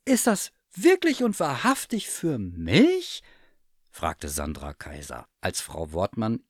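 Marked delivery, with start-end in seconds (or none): 0.0-3.2 high, slow disbelieving question; amazed delight. A narrator's reading. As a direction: ask it slowly, high with wonder. Cat amazed at her present.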